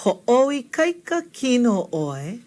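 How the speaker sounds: "Ho'oikaika kino oi" slowly, sound group by sound group; taking time. Shown as a demonstration. Teacher helps learners through a long word, slow careful model. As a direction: stretch out "Ho'oikaika kino oi" so learners can follow. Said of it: "Take your time".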